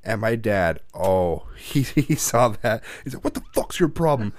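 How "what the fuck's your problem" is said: higher-pitched imitation of angry dad; mock parental telling-off; dad freaking out. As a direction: act out an irate father. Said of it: "like, freaking out on me".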